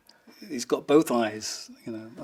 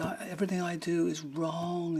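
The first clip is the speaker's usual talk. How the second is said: plaintive, higher-pitched self-blame; imitated near-crying; 5 semitones higher, 4 dB quieter than usual. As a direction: mimic a friend close to tears. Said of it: "like, nearly crying".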